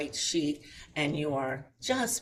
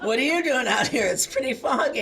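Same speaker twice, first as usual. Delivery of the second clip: loud laughing repeat of the line, less squeaky; laughing echo; 8 semitones higher, 8 dB louder than usual. Retold or imitated. Retelling the fisherman's words to the audience, loud and laughing. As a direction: laugh while repeating his words back. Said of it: "And we went".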